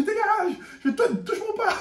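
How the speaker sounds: hammed-up shouted get lost; mock yell. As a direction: shove him off with your voice. Narrator shoving the boy off.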